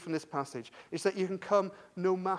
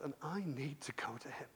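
a soft whisper, much quieter, like a secret decision; whispered resolve; 6 semitones lower, 14 dB quieter than usual. Acted. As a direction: breathe it to yourself, barely voiced. Jairus's inner resolve.

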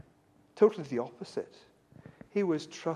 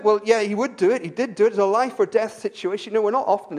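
loud, raised pulpit storytelling; impassioned preaching; 5 semitones higher, 7 dB louder than usual. Real, not acted. Preacher telling of Jeremiah facing the crowd, impassioned. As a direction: drive the story forward with rising force.

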